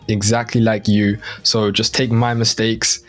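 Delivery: normal talking voice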